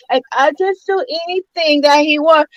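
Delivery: lightly put-on French accent, slow at the end; imitating her French accent, trailing slowly; mock French accent. Mocking a reality star's French accent, lightly. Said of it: "That's her accent".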